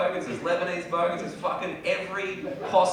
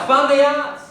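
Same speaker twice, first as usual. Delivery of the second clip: high, loud yell from a car; imitating a bogan shouting from a speeding car; mock yell; 9 semitones higher, 8 dB louder, slower than usual. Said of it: "revved past me being like".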